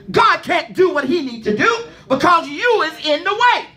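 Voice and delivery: high, fervent preaching climbing toward a cry; preaching at full force. Acted.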